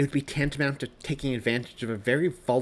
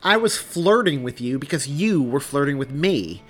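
loud, high, defensive comeback; raised-voice protest; angry retort; 5 semitones higher, 10 dB louder than usual. Acted. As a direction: throw the blame back heatedly.

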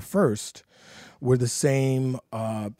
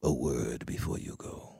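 low, soft, grave Shakespearean delivery; hushed, solemn stage recitation; Othello's death speech; 8 semitones lower, 7 dB quieter than usual. Acted. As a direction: recite it like a tragic hero dying. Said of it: "It's Othello's death scene".

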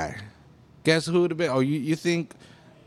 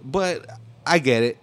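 ordinary talk, nothing marked

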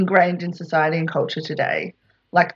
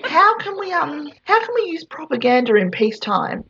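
scheming marketer; 9 semitones higher than usual. Retold or imitated. Voicing Bernays scheming.

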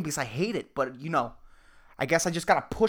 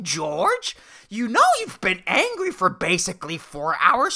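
much higher, taunting inner demon, slow and mocking; voice of evil; 5 semitones higher, slower than usual. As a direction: tease him like a gleeful little devil. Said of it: "This fucking voice of evil".

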